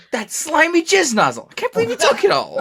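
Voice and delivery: mock outrage. Retelling the movie, high laughing mock outrage. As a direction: squeal it out, betrayed. Quoting Harley's outraged line.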